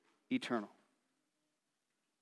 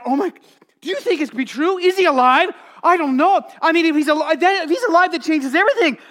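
much higher and louder, breathless with wonder; rising excited chatter, much higher and louder; excited disbelief; 9 semitones higher, 8 dB louder than usual. Acted. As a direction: gush it out like you can't believe the news. Women excited at empty tomb.

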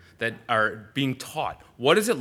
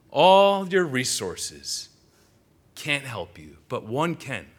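slow, louder emphatic preaching; fervent preaching; 5 semitones higher, 6 dB louder, slower than usual. Acted. Pastor pressing the congregation to depend on God, emphatic. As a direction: drive each "all" home to the congregation.

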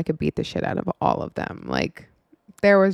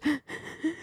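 sharp high tearful gasp; imitated sobbing intake of breath; crying gasp; 9 semitones higher, 8 dB quieter than usual. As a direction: gasp in before sobbing out the words. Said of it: "and hysterically crying".

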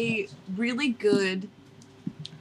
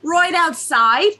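RIGHT as "roight", stretched and louder in Jersey; exaggerated Jersey accent; 5 semitones higher, 5 dB louder, slower than usual. Shown as a demonstration. Host redoing the phrase for friends, broad Jersey accent. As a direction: hammer "Right outside" in broad, slow Jersey. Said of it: "exactly what kind of Jersey accent".

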